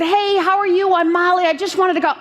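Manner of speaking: high, chipper self-introduction; imitating an eager hello; eager greeting. Retells greeting the manager.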